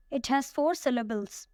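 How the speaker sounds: ordinary talk, nothing marked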